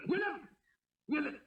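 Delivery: normal talking voice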